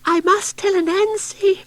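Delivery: frightened mouse voice; squeaky mouse. Acted. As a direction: squeak it like a scared little mouse.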